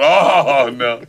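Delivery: loud, high dismayed "Oh, no". Retold or imitated. Dad finds meat on the plate, loud dismay. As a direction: groan it out in mock dismay. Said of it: "And I'm going".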